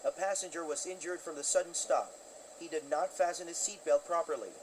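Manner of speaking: tense pilot calling the tower over the radio; pilot cockpit headset. Acted. Pilot asking for an ambulance.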